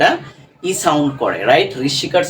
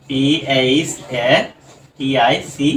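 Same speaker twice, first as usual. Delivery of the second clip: spelling out; 4 semitones lower than usual. Shown as a demonstration. Teacher spells out the word.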